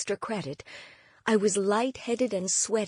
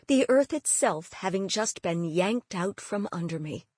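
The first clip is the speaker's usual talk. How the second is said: ordinary delivery, nothing marked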